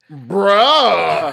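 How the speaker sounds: a loud, disbelieving bruh; loud shocked outburst; stunned bruh. Real, not acted. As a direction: react like you can't believe it. Friend drops the shocking news.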